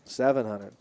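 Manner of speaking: ordinary talk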